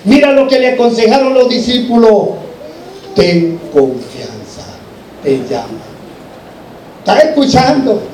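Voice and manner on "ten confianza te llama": low, soft, very slow words of comfort; gentle reassurance. Acted. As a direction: let each word sink in, hushed and slow. Disciples' counsel to blind man, voiced. (consuelo suave y pausado)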